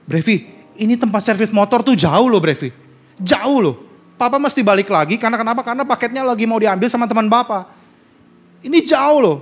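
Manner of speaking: omelan jengkel, nada tinggi dan lebih keras; kesal. Retold or imitated. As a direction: menegur anak dengan gemas. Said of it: "keselnya minta ampun". (ayah menegur anak yang lupa paket, kesal)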